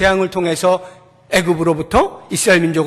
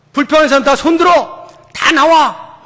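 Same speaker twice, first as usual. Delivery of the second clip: commanding shout; 6 semitones higher, 5 dB louder than usual. Retold or imitated. Proud Moses ordering complainers out.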